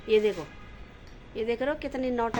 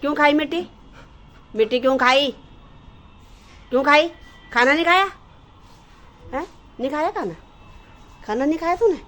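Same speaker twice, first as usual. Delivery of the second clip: loud, raised scolding of the dog; 5 semitones higher, 8 dB louder, faster than usual. Real, not acted. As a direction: demand answers in a cross, high voice. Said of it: "मैंने उसको डांटा कि".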